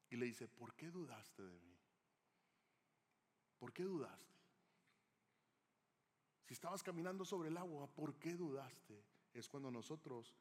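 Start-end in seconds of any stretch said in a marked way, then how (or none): 0.5-9.0 tender questioning. Acted. Jesus asking Peter why he doubted, soft and sorrowful.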